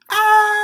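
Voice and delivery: loud cathartic scream. Real, not acted. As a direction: let out a full-throated scream. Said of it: "So I'm gonna have all y'all do this with me".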